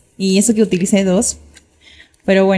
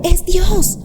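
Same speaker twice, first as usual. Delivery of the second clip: retold exclamation; 7 semitones higher than usual. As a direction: imitate the friend crying out, lightly. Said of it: "el grito que ella dio".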